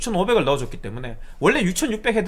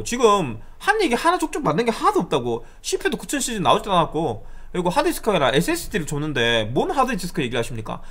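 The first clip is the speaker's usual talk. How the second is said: higher, heated re-enacted argument; retold angry comeback at a caller; raised-voice retort; 4 semitones higher than usual. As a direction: argue back hard, pitch climbing. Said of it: "내가 반대로 목소리를 높였습니다".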